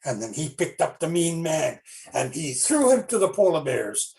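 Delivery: plain reading voice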